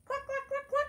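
high, loud clucking hen. Acted. Imitating a hen.

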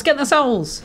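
put-on high, sing-song Welsh accent; doing a friend's Welsh accent. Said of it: "that's a solid Welsh accent".

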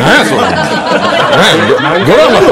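loud, high mock scolding; comic retort. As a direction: fire back the punchline loud.

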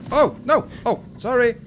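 high, loud flustered yelp; startled and apologetic; alarmed apology. Real, not acted. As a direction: blurt a panicked sorry. Accidentally hurt the beast.

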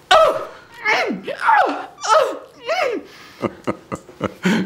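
very high, loud orgasmic tennis-player cries; mock tennis grunts. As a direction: wail each shot like phone sex. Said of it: "everyone's very vocal when they play tennis".

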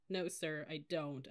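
plain reading voice

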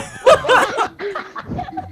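long, high burst of laughter; tawang-tawa. Real, not acted. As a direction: crack up at a silly scream. Natatawa sa sigaw ng kalaro. (mahabang halakhak na mataas)

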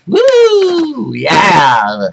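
high cheering woo; excited whoop. Real, not acted. Cheering at the FBI letter on camera, high whoop. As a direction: whoop with delight.

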